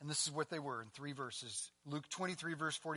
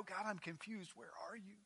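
quiet plea; 3 semitones higher, 8 dB quieter than usual. Acted. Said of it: "we go".

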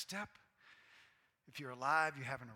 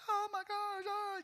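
squealing with delight, far higher; gushing proud parent; 6 dB louder, slower than usual. Retold or imitated. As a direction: gush over the baby's first step, high and loud. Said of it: "we're like".

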